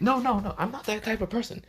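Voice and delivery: put-on high, eager denial in a girl's voice. A narrator's reading. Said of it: "tries to kind of go in an accent".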